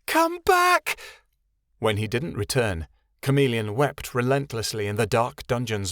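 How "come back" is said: very high, loud call; girl crying after departing Keanu. A narrator's reading. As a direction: call after him as he leaves. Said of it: "Chameleon cried".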